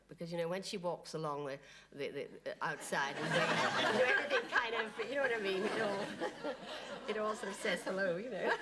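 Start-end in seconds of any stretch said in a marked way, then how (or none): none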